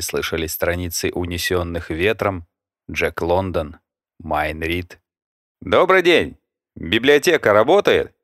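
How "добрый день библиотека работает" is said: called out, higher and louder; calling out. A narrator's reading. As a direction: call into the empty library. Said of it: "Голосом решил обозначить своё присутствие".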